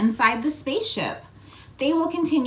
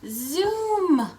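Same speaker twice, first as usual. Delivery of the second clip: zip "Zoom!" high and playful; 8 semitones higher than usual. Pretend. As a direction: zoom like a spacecraft. Space station sound effect.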